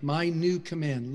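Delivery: ordinary talk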